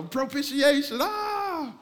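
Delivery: high-pitched hammed-up holler; mock prayer yelling. Preacher mocking wordy prayers, yelling. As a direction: cry out the big word in mock fervour. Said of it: "You're just yelling the biggest words".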